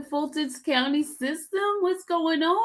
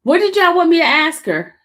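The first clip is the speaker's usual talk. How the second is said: raised voice, higher and louder; calling out; 4 semitones higher, 8 dB louder than usual. Real, not acted. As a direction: throw the question out to the audience. Calling out to the live chat.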